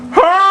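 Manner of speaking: mock scream of pain from the ward; a high cry of pain; high pained yell.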